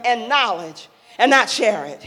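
ordinary talk, nothing marked

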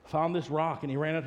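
usual speaking voice

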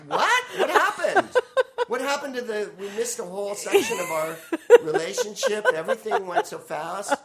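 high, breaking into laughter; laughing. Real, not acted. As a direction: push the words out while laughing hard. Cracking up at own joke.

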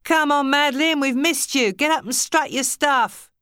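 high, loud cheering call from a crowd. A narrator's reading. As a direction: shout her up to the stage, coaxing. Crowd cheers Madeline to stage.

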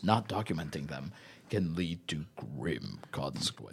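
low, soft, ominous sultry read; mock sexy. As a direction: sink into a low seductive hush. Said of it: "trying to make these sound sexy".

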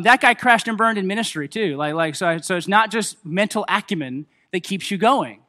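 ordinary talk, nothing marked

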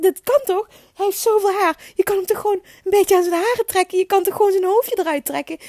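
high, loud, insistent protest; exasperated plea. Retold or imitated. Mother reasoning desperately with midwife, high pleading voice.

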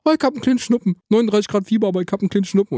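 lightly voiced wife playing it down; mock casual wife voice. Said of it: "und dann so".

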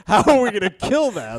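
laughing. Retold or imitated. Laughing quote during date story.

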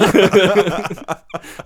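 loud, high burst of laughter; laughing at scoring herself a point; real laughter. Real, not acted. Laughs at her own counter.

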